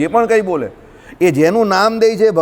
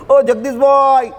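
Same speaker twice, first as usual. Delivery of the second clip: calling out; 6 semitones higher, slower than usual. Pretend. Calling out to God as Jagdishbhai.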